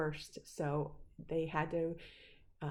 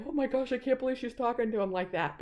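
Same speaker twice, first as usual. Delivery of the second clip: higher, louder, quick mock disbelief; shocked onlookers; 5 semitones higher, 5 dB louder, faster than usual. Everybody else watching her scold Nick, shocked. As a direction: imitate scandalized bystanders in a rush.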